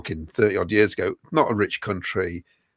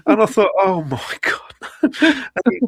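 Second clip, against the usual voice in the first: laughing; 9 semitones higher than usual. Real, not acted. Laughing recalling the injection.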